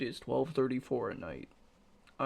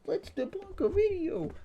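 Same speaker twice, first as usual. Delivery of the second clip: squeaky mock-excited viewer voice; mocking high voice. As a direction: mimic an eager fan, pitched way up. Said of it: "you're like".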